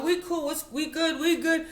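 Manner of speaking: very high, loud placating reply; mock reassurance. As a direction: act out brushing off the boss in a high voice. Retold reassurance to angry boss.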